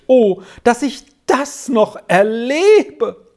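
high, slow cry of overwhelmed joy; tearfully overjoyed. A narrator's reading. Old Trine overcome at the reunion, tearfully overjoyed. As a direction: exclaim high and drawn out, moved. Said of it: "Jetzt kamen die Tränen erst recht".

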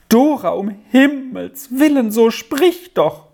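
high-pitched, urgent alarm; horrified crying out. A narrator's reading. Aunt begs her bloodied niece to speak, horrified outcry. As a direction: press her with frantic, high questions. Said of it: "schrie die Tante bei dem Anblick auf".

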